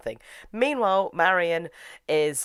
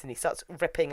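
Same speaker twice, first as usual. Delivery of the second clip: ordinary delivery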